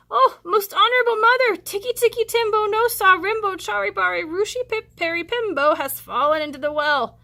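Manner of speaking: put-on high, loud boy's voice; be a small child rushing to his mother; urgent little boy; little boy calling. Small boy Chang calls mother.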